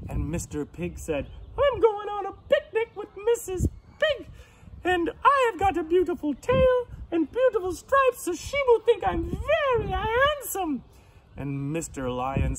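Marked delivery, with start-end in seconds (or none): 1.6-10.8 very high, loud piggy voice; proud pig. Acted. Pig tells lion about his picnic, high and proud. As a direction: boast in a high pig squeal.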